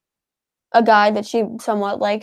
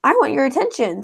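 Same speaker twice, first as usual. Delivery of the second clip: voicing the dog; 5 semitones higher than usual.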